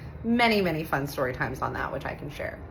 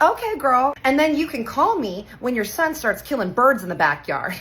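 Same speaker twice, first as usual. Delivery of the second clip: louder, higher sassy clapback; sassy comeback; 7 semitones higher, 5 dB louder than usual. Retold or imitated. Aunt snapping back at the mother threatening charges, sassy. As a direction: throw shade right back at her.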